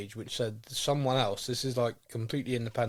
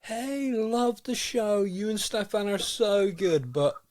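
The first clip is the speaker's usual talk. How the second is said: gushing fan voice; 9 semitones higher, 3 dB louder, slower than usual. Retold or imitated. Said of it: "You know, like".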